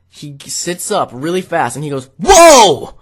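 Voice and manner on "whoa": sudden high, loud yell; imitated scream. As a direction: shout it like jolting awake mid-dream. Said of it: "he screams when he si-, sits up".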